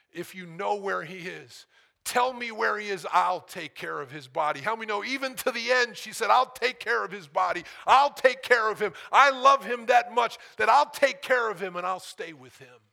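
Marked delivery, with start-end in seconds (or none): 0.1-4.6 urgent plea. Acted. Mary pleading with gardener. 6.3-12.8 loud, high, fervent pledge; impassioned vow. Acted. Preacher voicing Mary's vow to the gardener, loud and fervent.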